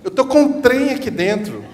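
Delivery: put-on high, loud little child's voice; a child struggling to name a feeling; child complaining. Child telling a mother from Minas about a bad feeling, whiny. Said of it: "a criança chega pra mãe mineira e fala assim".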